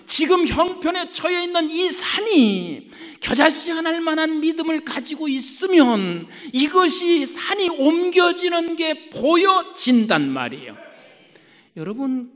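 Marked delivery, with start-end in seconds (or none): 0.0-10.8 higher, driving sermon voice; forceful preaching. Acted. Preacher presses the point about the mountain, forceful preaching.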